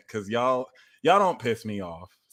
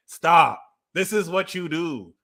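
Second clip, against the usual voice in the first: normal talking voice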